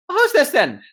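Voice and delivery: high, loud little captain voice; gnome captain. Acted. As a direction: size them up as a brisk gnome captain.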